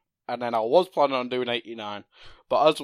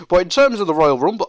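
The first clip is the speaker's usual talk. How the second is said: ordinary talk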